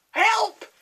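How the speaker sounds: high, loud witch scream for help. A narrator's reading. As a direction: shriek as she flies off. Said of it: "she screamed".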